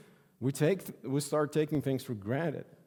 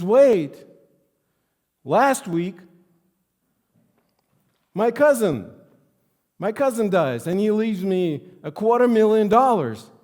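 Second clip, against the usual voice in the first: louder, higher retelling; 8 semitones higher, 8 dB louder than usual. Retold or imitated. Sad man tops his story.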